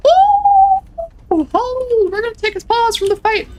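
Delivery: high, loud startled shriek; startled yelp. Real, not acted. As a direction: jump in surprise, then stay high. Sudden enemy appears.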